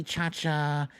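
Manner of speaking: normal talking voice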